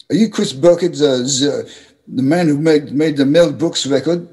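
imitated German accent, halting start. Big guy backstage asking the musician about his record, German accent. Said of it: "in his German accent".

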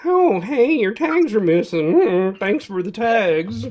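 high, loud, slow sing-song mock of a busybody; mocking the lady. Cohost mocks the stranger at the drive-thru, sing-song. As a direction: mimic the nosy stranger with syrupy fake helpfulness. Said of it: "it's like".

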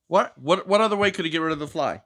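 ordinary delivery, nothing marked

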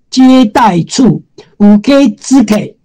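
ordinary talk

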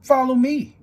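loud high call to the band; big brother calling. A narrator's reading. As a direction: lead the band with a shout. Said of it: "James would say".

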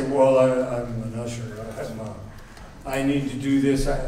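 low, halting mock excuse; reluctant men. Men dodging nursery duty, mock reluctant. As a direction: lightly mimic men backing out, low and mumbly. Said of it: "And the men say".

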